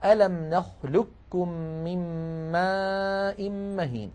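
نخلقكم recited very slowly with the qaf merged into the kaf; slow Quran recitation. Shown as a demonstration. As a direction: chant ألم نخلقكم drawn out. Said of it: "وهي قوله تعالى".

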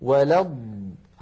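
slow, low "ولا الض" lengthening the dad; still dad held. Shown as a demonstration. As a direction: press and hold the dad ending "ولا الض". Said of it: "الضاد الأولى ساكنة". («ولا الض» بضاد ساكنة مطوّلة)

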